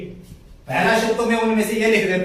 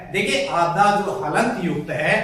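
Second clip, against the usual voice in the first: normal talking voice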